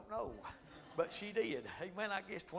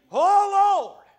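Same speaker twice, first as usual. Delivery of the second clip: much higher, much louder hollering to God; altar hollering; 21 dB louder than usual. Retold or imitated. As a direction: cry out like old-time altar call. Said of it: "crying out to God".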